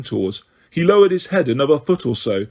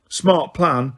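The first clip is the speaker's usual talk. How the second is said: plain reading voice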